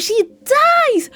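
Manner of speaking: high, loud and slow, stunned; shocked exclamation. Real, not acted. Telling viewers the character dies, high stunned outcry. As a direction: blurt it out in disbelief. Shocking twist in game recap.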